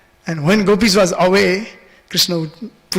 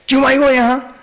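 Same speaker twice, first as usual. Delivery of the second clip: disrespectful Hindi question, pitched high; Krishna; 7 semitones higher, 4 dB louder than usual. Retold or imitated. Little Krishna to the elderly gopi, no respect. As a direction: demand it rudely, high and pushy. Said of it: "Krishna is not even respecting".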